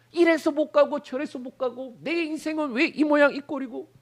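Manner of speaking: aggrieved complaint. Retold or imitated. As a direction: whine about a ruined life. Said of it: "억울함과 분통함과". (높고 억울하게 푸념하며)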